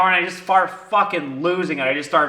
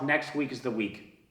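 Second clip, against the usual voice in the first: low-key, softer self-assurance; soft private mantra; quiet self-talk; 3 semitones lower, 9 dB quieter than usual. Retold or imitated. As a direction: tell yourself quietly, almost under your breath. Quiet self-talk before louder repeat.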